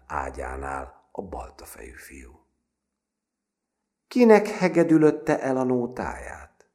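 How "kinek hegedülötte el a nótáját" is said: high, loud menacing taunt; mocking bully, pitched way up; dangerous grin. A narrator's reading. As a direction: question the victim through a threatening grin. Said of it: "kérdezte veszedelmesen vigyorogva".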